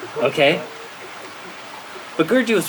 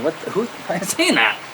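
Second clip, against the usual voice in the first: higher-pitched, incredulous question; baffled; 5 semitones higher than usual. Retold or imitated. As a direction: ask it in baffled disbelief. Baffled by a voice nobody else hears.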